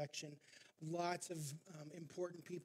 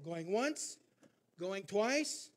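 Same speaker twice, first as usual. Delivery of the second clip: much louder, ringing out the sale; auctioneer's final call; 3 semitones higher, 9 dB louder, slower than usual. Retold or imitated. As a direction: slam it home like the gavel falls. Auctioneer bangs gavel, sells.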